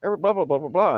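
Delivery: imitating the aunt gushing to mom; aunt's high-pitched babble trailing into blah blah; excited aunt. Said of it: "to my mom, she says".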